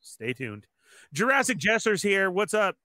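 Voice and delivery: ordinary talk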